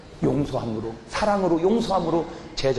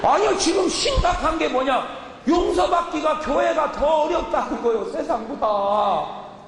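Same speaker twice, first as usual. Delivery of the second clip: preaching shout; 11 semitones higher, slower than usual. Real, not acted. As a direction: cry the point out to the hall. Preacher shouting his main point.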